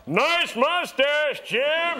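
high, drawn-out heckle; yelling out. Retold or imitated. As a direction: holler it slowly like someone across the crowd. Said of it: "people were yelling out".

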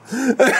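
high burst of laughter; sheepish laughter at the memory; embarrassed laugh. Real, not acted. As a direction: laugh at yourself. Laughing at his own nervousness.

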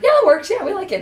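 high-pitched mock casting team; loud start, very high quoted approval; imitated delighted approval. Acting out the casting team's approval.

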